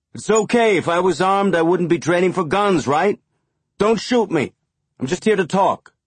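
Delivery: high, loud, friendly reassuring call. A narrator's reading. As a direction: shout it cheerfully, hands up. Amos calling out, hands raised.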